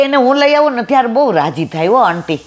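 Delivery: imitating the boy's delighted rise; excited son. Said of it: "આખો એનો change થઈ ગયો ટોન".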